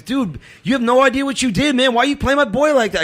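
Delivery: high-pitched, indignant imitation of the guy; accusing stranger. Stranger challenges him outside church, imitated high indignant voice. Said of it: "He goes".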